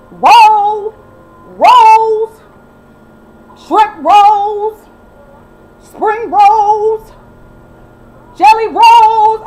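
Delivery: loud, high mocking hollers; mock yell. Mocking Kelly yelling for her husband, loud and high. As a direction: yell it like calling across a restaurant.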